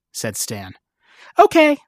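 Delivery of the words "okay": bright. Acted. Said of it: "Lucy said brightly".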